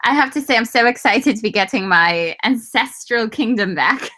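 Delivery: ordinary delivery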